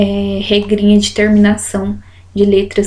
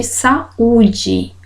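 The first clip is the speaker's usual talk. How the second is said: "saúde" slowly split, stress on the ú; slow "saúde" with the ú standing alone; stressed separate U. Shown as a demonstration. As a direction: break "saúde" into sa-Ú-de, leaning on Ú. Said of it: "O U ficou sozinho".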